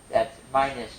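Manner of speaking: normal talking voice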